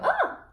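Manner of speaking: a very high startled gasp-cry in head voice. Shown as a demonstration. Therapist demos a startled cry in head voice. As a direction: yelp as if someone crept up behind you. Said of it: "Voz de cabeza".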